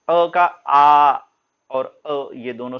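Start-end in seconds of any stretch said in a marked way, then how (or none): 0.1-1.2 अ का आ said very slowly, आ drawn out; slow vowel demo. Shown as a demonstration. Teacher showing students अ and आ, very slowly. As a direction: spell out अ then a long आ, slowly. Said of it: "तो ऐसे".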